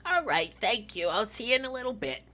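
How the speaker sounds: put-on high, elderly sign-off; old lady. Old librarian thanks Vincent and hangs up, high elderly voice. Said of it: "it's the old lady".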